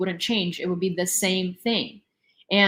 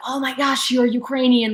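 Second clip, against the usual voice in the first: mock gushing; 5 semitones higher, 4 dB louder than usual. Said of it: "have to be like".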